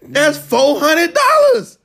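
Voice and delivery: slow, high, loud disbelief; incredulous. Real, not acted. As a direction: stretch it out in shock. Shocked at total price.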